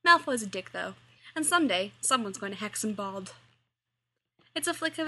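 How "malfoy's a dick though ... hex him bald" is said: ordinary delivery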